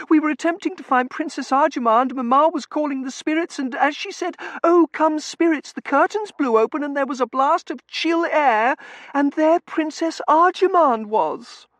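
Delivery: very high, loud, gushing account; excitable daughter. A narrator's reading. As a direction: tell the tale breathlessly up in a high pitch. Excitable daughter tells of séance.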